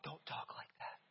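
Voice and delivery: whispered scolding to the son; hushed rebuke. Retold or imitated. Father quietly scolding son for insulting sister, whispered. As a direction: hiss it low so only he hears.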